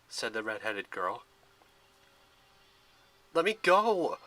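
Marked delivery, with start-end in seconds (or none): 3.3-4.2 loud, high forceful order; loud demand. A narrator's reading. Percy held back by the hunters, loud angry demand. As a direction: insist on being let go. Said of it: "Percy demanded".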